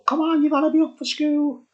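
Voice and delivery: high, calling mother's voice; a mum calling the kids in for bed. Retold or imitated. Mums outside the pub calling kids home, high and calling.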